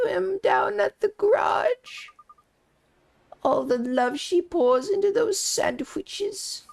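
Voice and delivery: put-on high old woman voice, welling up; a nosy neighbour half-crying over the family's troubles; gossiping old lady, tearful. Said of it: "such an authentic voice for that character".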